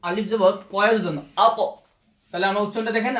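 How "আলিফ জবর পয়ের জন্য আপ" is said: আপ said with a bouncing echo on the final প; আপ: stop the lips hard on প so it pops back, qalqala style; Arabic letter drill. Shown as a demonstration. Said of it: "আমি কলকলা করে বলছি আপ". (আপ শব্দের প কলকলা করে উচ্চারণ)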